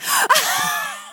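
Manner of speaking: squeaky mock fan laugh; hammed-up high laugh. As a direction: falsetto giggle.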